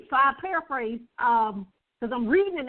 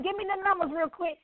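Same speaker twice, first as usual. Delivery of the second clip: usual speaking voice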